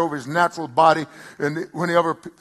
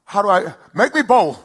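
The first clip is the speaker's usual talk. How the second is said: pleading prayer; 5 semitones higher, 7 dB louder than usual. Retold or imitated. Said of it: "I said".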